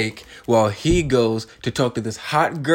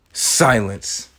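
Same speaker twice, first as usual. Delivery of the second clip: ordinary delivery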